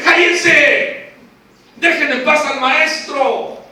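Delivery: loud rebuke from the crowd; acted scolding shout. Crowd telling blind men to leave the teacher alone, scolding. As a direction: shush them forcefully.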